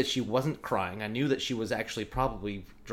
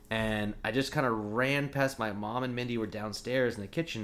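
normal talking voice

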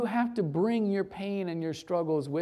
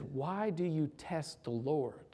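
quiet question; 2 semitones lower, 8 dB quieter than usual. Acted. Moses quietly rebuking the grumbling people, softly. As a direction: ask it gently, almost under breath. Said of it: "he says".

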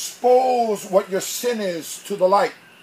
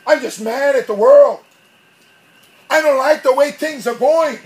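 loud, higher mock venting; acted anger; 4 semitones higher, 6 dB louder than usual. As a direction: vent like someone mad at the world. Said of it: "I'm pissed off".

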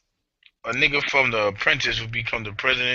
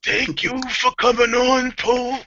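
mock announcer shout; 10 semitones higher, slower than usual.

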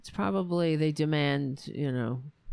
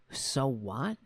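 incredulous echo; 4 semitones lower, 3 dB quieter than usual. Real, not acted. Repeating Trump's reply back in plain disbelief, rising.

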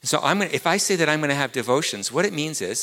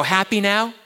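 sarcastic quote; 9 semitones higher, 5 dB louder than usual. Retold or imitated. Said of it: "you go".

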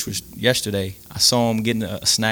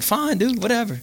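high-pitched, mock-exasperated brush-off; retold "have it your way" in a high pitch; exasperated giving in; 13 semitones higher than usual. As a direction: toss it off in a high, put-on voice. Acted giving in to buddy.

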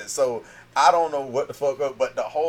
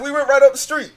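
high innocent voice; 13 semitones higher, 8 dB louder than usual. Retold or imitated. Retold own answer.

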